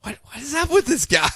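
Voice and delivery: high, small voice of disbelief; baffled onlookers. Retold or imitated. Everyone looking around at straining Superman, high baffled voice. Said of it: "everyone's just looking around like".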